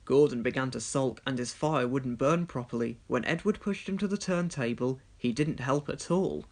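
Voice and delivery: usual speaking voice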